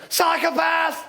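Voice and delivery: high-pitched heckle yelled at a passer-by; imitated yell. Woman on a bicycle yells at a walker, mock yell. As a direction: yell it like a cyclist riding past. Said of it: "I don't like being yelled at".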